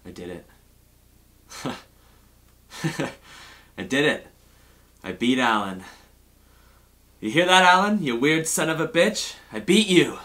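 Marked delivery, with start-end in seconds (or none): 3.8-10.2 louder, higher victory crow; triumphant gloating. Real, not acted. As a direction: gloat loudly at the absent rival. Gloating triumph over Alan.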